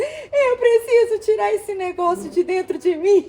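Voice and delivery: desperate to get it out of her body; tearful plea. Retold or imitated. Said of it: "Eu comecei a chorar ali".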